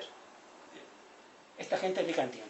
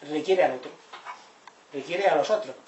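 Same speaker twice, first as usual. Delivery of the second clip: ordinary talk, nothing marked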